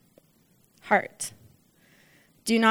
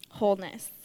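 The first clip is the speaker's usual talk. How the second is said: normal talking voice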